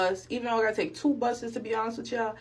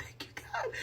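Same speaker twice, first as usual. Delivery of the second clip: whispered, tearful gratitude; retold hushed thanks through tears; 13 dB quieter than usual. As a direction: whisper it as if overcome with relief. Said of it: "I'm already tearing up".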